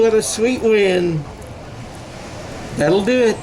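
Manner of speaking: normal talking voice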